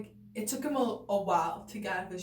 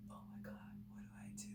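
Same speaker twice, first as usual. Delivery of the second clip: fast, breathless whisper of panic; panicked whisper; 19 dB quieter, much faster than usual. Retold or imitated. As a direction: whisper it like a frozen onlooker who doesn't know what to do. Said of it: "looking like".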